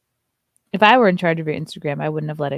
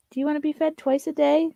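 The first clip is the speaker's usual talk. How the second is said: high, sing-song pet voice; 8 semitones higher than usual. Real, not acted. To her dog Muffin, high cooing baby talk. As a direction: coo at the dog.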